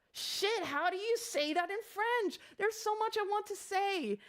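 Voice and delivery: high-pitched, flustered self-questioning; flustered mock panic. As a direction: act out a panicked hunt for words. Retells panic at forgetting French.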